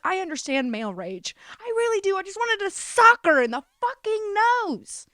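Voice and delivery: half-joking fury at her own reflection; mock rage. Glaring into the mirror, wanting to punch it, in a mock rage. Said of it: "I was like".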